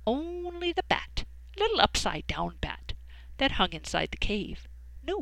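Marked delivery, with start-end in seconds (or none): none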